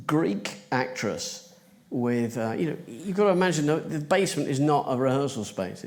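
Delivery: ordinary talk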